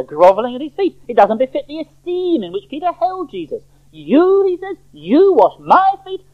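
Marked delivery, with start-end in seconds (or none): 3.9-4.4 "You" thrown out high and loud; loud, incredulous punch on "You"; emphatic pronoun. Acted. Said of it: "The pronouns are very emphatic". 4.9-6.2 "you" and "my" hit high and loud, slowly; slow, loud stress on "you" and "my"; emphatic pronouns. Acted. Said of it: "The pronouns are very emphatic".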